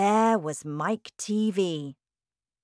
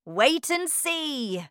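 high, drawn-out, playful reply; teasing cry; 9 semitones higher, slower than usual. A narrator's reading. Wonka withholding the secret from Charlie, crying gleefully. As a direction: stretch it out to build suspense. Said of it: "cried Mister Wonka".

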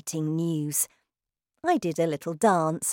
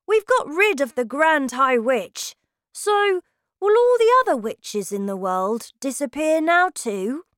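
put-on high, loud child's voice, full of questions; eager boy; 8 semitones higher, 6 dB louder than usual. A narrator's reading. Boy asks grandmother about the other witches, eager high voice. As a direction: ask like an eager little boy.

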